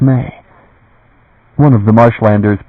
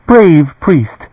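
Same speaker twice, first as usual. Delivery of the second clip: still, clear voice; 7 semitones higher than usual. A narrator's reading. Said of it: "in a voice still and clear".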